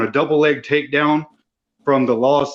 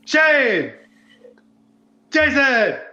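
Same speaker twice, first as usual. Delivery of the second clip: excited greeting; 12 semitones higher, much slower than usual. Real, not acted. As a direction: call each name out much higher, with delight. Guest greets the hosts on joining.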